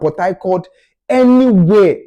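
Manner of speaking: ordinary delivery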